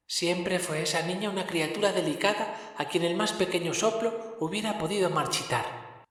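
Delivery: más agudo y suave, voz de espíritu lejano; el fantasma habla quedo y solemne; voz del espectro. A narrator's reading. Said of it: "dijo el espectro". (espectro de Navidad, voz aguda y suave)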